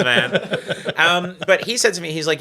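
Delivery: ordinary talk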